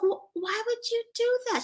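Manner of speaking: very high, incredulous question; retold squeaky bewilderment; perplexed friend. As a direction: imitate a baffled friend, voice way up. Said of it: "She was so perpl-, so perplexed".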